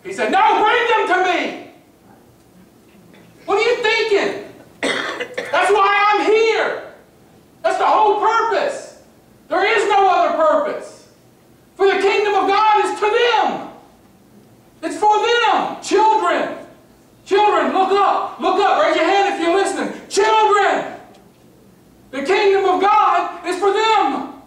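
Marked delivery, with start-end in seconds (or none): 0.3-15.8 high, forceful preacher's outburst; emphatic, indignant command. Acted. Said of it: "That's not indignant". 15.8-24.4 put-on high, emphatic call to the children; ringing, insistent appeal. Calls children to listen, preaching.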